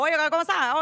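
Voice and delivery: quick Cuban Spanish greeting; Cuban accent. Shown as a demonstration. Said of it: "And then you go".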